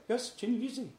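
high put-on Scottish accent; imitating a Scottish speaker. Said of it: "the Scottish pronunciation".

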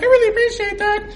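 grateful cashier. Retold or imitated. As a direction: chirp your thanks.

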